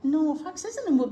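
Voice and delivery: ordinary talk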